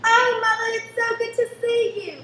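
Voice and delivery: excited greeting. Acted. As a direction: rush in thrilled to see mother. Daughter greets mother on arriving home.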